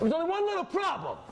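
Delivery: sudden high, punchy announcement; spring the twist on the class; dramatic reveal. Real, not acted.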